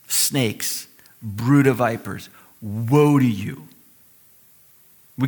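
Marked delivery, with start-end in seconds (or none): 0.1-3.7 low, slow, grave pronouncement; stern denunciation. Acted. Preacher quoting Jesus condemning the Pharisees, stern and grave. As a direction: let each condemnation land slowly and heavily. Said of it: "can't imagine Jesus talking like this".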